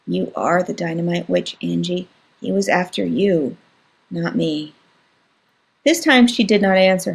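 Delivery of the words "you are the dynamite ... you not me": soft, hushed and earnest; quite quietly. Acted. Little brother insisting his sister is the witch, quietly. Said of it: "He said, quite quietly".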